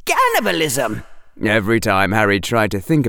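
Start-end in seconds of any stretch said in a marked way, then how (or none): 0.1-1.0 falling mock cry of alarm, no real volume; mock shout. A narrator's reading. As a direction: cry out as if horrified, without shouting. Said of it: "Hufflepuff was shouting".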